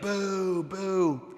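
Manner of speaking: lightly imitated booing crone; heckling old hag. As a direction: jeer like the hag in the film. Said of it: "Remember the lady that's like".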